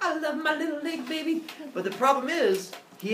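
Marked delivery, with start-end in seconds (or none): 0.0-1.4 high-pitched gushing affection; hammed-up, high adoring voice; doting baby talk. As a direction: coo over a beloved baby. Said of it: "Zeus loves his leg baby".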